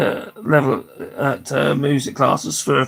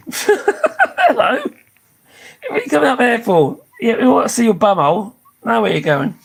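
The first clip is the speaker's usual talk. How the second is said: pet talk; 6 semitones higher than usual. Real, not acted. Greeting her pet climbing onto her, high cooing pet talk. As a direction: coo up at the pet with a laugh.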